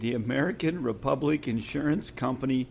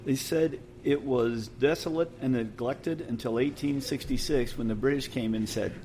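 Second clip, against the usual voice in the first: usual speaking voice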